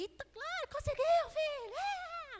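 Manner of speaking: very high, squealed cheer; doting mother cheering. Retold or imitated. Mother cheering son's first bike ride, gushing squeal. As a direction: squeal with over-the-top maternal pride. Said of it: "Aquela coisa de mãe, né, babona".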